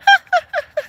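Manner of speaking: high shrill imitated giggle; mock girlish giggle. Coworker telling viewers about a giggling young girl with a mock giggle. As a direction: mimic a silly girl giggling. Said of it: "Giggle, giggle, giggle".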